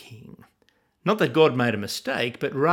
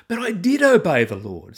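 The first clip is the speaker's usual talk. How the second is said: higher, louder protest of innocence; insisting; 5 semitones higher, 5 dB louder than usual. A narrator's reading. Saul defending himself to Samuel, higher and louder. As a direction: push back hard, defensive. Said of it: "Saul insisted".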